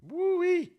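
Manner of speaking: very high "woo-wee"; whistle-high exclamation of amazement; high whoop. Real, not acted. Reacting to the fall.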